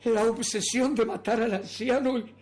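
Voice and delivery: loud, driven dramatic narration; mounting obsession. Acted. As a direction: raise the voice as obsession takes hold. Narrator's murderous obsession grows.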